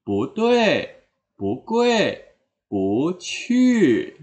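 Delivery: "Bu tue, bu kue, bu qu", rising then falling; second-tone bu examples. Shown as a demonstration. Teacher reciting examples, rising "bu" before falling syllables. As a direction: lift each "bu", drop what follows. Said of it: "nada keempat".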